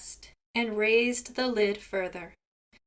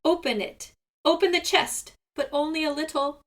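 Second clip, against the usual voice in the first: pained urgent plea; 6 semitones higher than usual. A narrator's reading. Seagull in pain giving orders.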